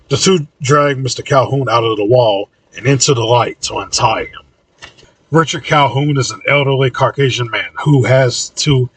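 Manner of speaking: ordinary delivery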